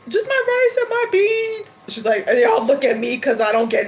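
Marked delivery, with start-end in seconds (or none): 0.1-1.7 much higher, light innocent voice; sweet small order. Retold or imitated. Ordering rice and beans from the server, much higher and sweet. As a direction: order meekly like a little girl. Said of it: "I'm like".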